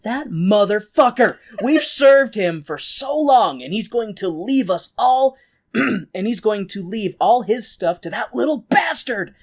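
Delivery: lightly hammed-up angry schemer; indignant Trunchbull rant. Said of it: "lurking in the shadows and is like".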